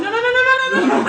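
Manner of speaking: high panicked protest. Acted. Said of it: "Where I'm going".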